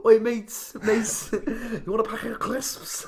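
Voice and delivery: high, slow mock Scouse; Liverpool accent. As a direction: imitate a Liverpool lad's voice message. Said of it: "with his Liverpool accent".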